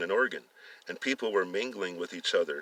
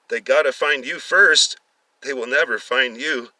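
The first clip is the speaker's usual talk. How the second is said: louder, coldly taunting; menacing taunt; 4 semitones higher, 7 dB louder than usual. A narrator's reading. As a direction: taunt the tied-up captives. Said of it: "Daniel said".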